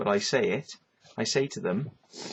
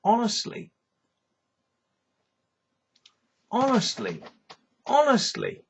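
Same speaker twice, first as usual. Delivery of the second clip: rising higher with each exasperated honestly; 10 semitones higher than usual. Real, not acted. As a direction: pitch it up in disbelief each time.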